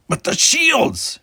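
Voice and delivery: high, loud, slow anguished cry; horrified cry. A narrator's reading. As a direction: cry out in horror for the ship. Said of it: "he cried".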